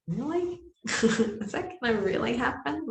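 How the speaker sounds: high, doubting inner voice with a laugh; incredulous. Retold or imitated. Ego doubting the guidance, incredulous and high. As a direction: scoff at the idea in disbelief.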